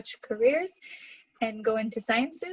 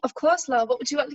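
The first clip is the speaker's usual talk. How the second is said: playful, slightly raised British accent; 3 semitones higher, 4 dB louder than usual. Shown as a demonstration. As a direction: put on a chummy British accent. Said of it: "Can you do an accent?".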